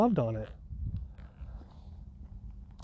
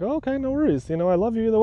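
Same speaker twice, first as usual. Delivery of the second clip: higher, airy mock-breezy reply; mock surprised; 7 semitones higher, much faster than usual. Answering his ego's joke, mock surprised and high. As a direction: brush off the prank in a bright, high voice.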